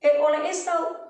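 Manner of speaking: ordinary delivery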